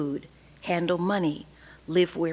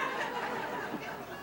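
soft laugh; 13 dB quieter than usual. Real, not acted. Laugh after carbon paper joke.

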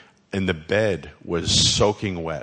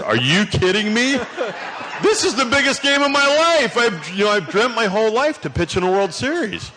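much higher and louder, disbelieving protest; incredulous outburst; 14 semitones higher, 4 dB louder than usual. Retold or imitated. Pitcher protesting to his wife that he must pitch, high loud disbelief. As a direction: blurt it out, outraged at the very idea. Insists on pitching despite illness.

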